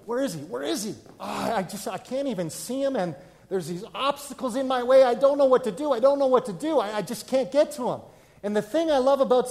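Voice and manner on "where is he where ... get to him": higher, louder, mounting frustration; frustrated seeker. Retold or imitated. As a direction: act out someone who can't see Jesus. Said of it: "this is what it's like".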